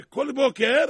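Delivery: loud, high burst; emphatic reveal. A narrator's reading. Preacher reveals to listeners what Pharaoh does, emphatic loud reveal.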